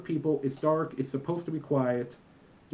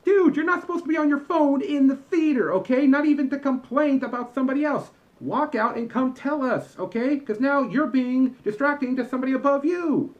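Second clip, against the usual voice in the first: much higher, louder exasperated scolding; 11 semitones higher, 6 dB louder than usual. Acted. Usher scolding phone callers in the theater, high and loud. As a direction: raise your voice and lecture them.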